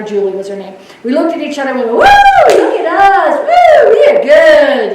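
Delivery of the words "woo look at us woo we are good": high, loud gleeful whoops; cheering. Retold or imitated. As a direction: cheer like you just won. Nurses celebrating, cheering.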